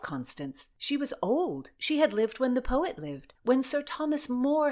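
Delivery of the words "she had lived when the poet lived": ordinary delivery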